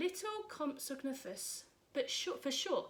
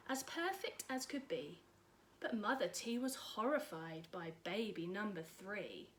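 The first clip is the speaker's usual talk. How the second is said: ordinary delivery, nothing marked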